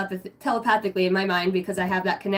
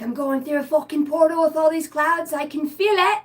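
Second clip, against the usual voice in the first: higher, thrilled voice; awed excitement; 6 semitones higher than usual. Retold or imitated. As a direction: marvel as if crossing a portal. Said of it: "you're like".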